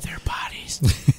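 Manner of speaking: a sly whisper under the story; whispered aside. Real, not acted. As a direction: whisper the joke so only the table hears. Cheeky aside during friend's story.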